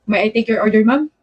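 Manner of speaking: ordinary talk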